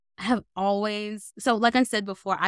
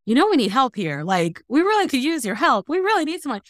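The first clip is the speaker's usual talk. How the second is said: pleading director; 6 semitones higher, 4 dB louder than usual. Retold or imitated. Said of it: "the director kept saying to me".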